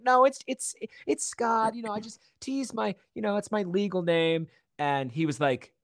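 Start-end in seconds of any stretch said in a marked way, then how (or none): 0.0-4.5 high, stammering self-imitation; flustered explaining. Actor explains his name to supervisor, high and flustered. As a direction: stumble through an apologetic explanation, pitched high.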